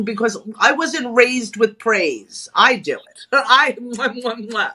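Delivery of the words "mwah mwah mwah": ordinary talk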